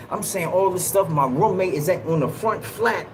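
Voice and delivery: ordinary delivery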